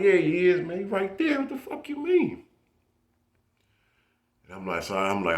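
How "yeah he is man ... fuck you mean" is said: rising high incredulous protest; incredulous insistence. Retold or imitated. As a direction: voice climbing high in disbelief. Insisting friend is really there.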